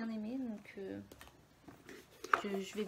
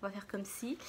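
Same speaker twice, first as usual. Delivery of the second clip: whispering. Real, not acted. Whispered aside while unboxing.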